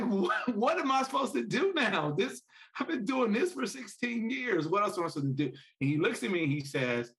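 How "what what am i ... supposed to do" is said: high-pitched, distraught retold plea. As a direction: voice rising high in remembered panic. Father retells his distress to son.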